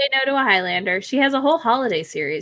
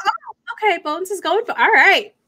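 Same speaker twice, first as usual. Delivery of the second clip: mock astonished acceptance; 7 semitones higher than usual. Reader to friends, retelling the scene with high astonishment. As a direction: go high, shocked but game. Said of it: "I was like".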